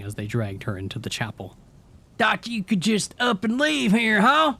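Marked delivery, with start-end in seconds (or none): 2.2-4.5 high, loud growling taunt; Paul growling. A narrator's reading. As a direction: growl it roughly, mocking. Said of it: "Paul growled roughly".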